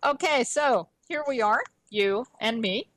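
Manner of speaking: ordinary talk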